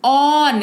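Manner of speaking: ऑन held long on an open "aw"; teacher's model ऑन with rounded open "aw"; open "aw" sound. Shown as a demonstration. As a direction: draw out ऑन emphatically as "awwn". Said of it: "ओ का उच्चारण औ के तौर पर हो रहा है".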